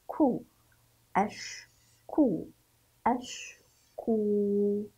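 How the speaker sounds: "كو" and "أش" each said alone with long pauses; isolated syllables. Shown as a demonstration. Teacher shows pupils how to read it, "أش" and "كو" syllable by syllable. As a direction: sound out "أش" and "كو" separately, holding the ū. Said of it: "كيف سنقرأه إذن؟".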